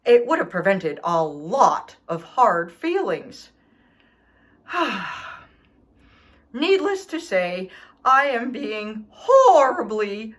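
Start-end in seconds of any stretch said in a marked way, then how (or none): 4.7-5.3 long weary sigh; heavy put-upon sigh. Acted. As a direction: sigh like a hard-done-by dog. Dog sighs over his woes.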